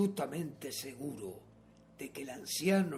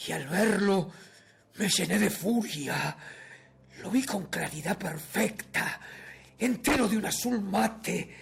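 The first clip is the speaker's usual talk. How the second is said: loud, raised, feverish narration; furia obsesiva; 5 semitones higher, 5 dB louder than usual. A narrator's reading. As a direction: rave about it with mounting horror. Narrador enloquecido ante el ojo. (narración furiosa y enloquecida, en voz alta)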